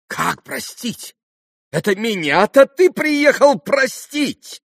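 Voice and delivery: very high, loud, incredulous cry; outraged interruption. A narrator's reading. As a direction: cut in, shrieking with outrage. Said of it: "перебила Грушенька и вскочила с места". (возмущённый высокий крик)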